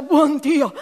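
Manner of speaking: esclamazione senza fiato, forte; ansimando. A narrator's reading. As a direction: ansima mentre si rialza. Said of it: "disse Baines ansimando". (Baines ansima rialzandosi da terra)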